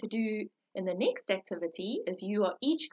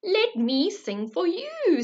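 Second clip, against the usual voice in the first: high bird voice; 7 semitones higher, 6 dB louder, slower than usual. Acted. Thrush offering song.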